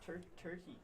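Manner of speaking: put-on Irish accent. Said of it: "It's more Irish".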